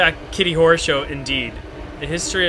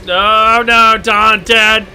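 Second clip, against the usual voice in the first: shocked shout; 5 semitones higher, 8 dB louder, much slower than usual. Real, not acted. Shocked reveal in game.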